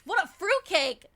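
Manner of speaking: loud, slow, scandalized gasp; outraged exclamation. Acted. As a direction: call it out in mock outrage. Mock outrage at the character.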